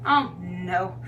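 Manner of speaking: normal talking voice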